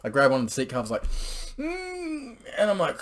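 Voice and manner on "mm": a high squeaking moan held on one note; high strangled moan. Retold or imitated. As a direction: let out a high tight moan at the peak. Climax moment retold.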